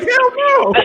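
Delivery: high, loud mock yelp of refusal; startled refusal. Pregnant lady refusing the giant, high mock yelp. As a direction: play the scared mom snapping no. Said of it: "And she's like".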